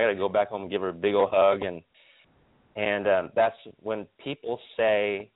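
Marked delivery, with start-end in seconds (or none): none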